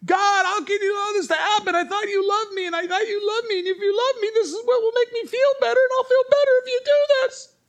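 hammed-up shrieking complaint to God; whining tantrum. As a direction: wail like a child mid-tantrum. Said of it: "Did I sound like the, the voice in your head?".

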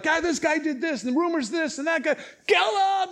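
high agitated clamour building to a shout; accusing mob. Acted. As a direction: pile up accusations, then erupt. Voicing the angry mob's accusations.

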